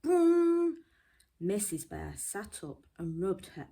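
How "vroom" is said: very high, loud vroom; alarm clock ringing. Acted. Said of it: "went the alarm clock".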